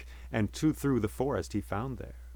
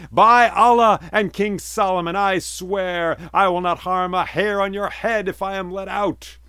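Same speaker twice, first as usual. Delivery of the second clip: high, loud, solemn oath; genie swearing an oath; 8 semitones higher, 9 dB louder than usual. A narrator's reading. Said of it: "the genie spoke and said".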